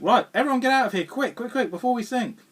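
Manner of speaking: fast, loud, high mock alarm; urgent order. Crew leaving the sinking Klingon ship, mock urgent shout. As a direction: hurry the crew out, half-joking. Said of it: "and they think".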